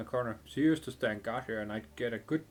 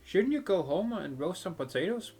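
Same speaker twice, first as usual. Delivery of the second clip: put-on much higher, teasing child; cheeky girl voice; 7 semitones higher than usual. Girl in the window taunting the mercenary, high cheeky voice. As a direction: needle the big guy like a brat.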